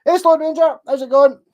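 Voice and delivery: loud, high hello to a viewer; calling out greeting. Real, not acted. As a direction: shout a cheery hello across the room.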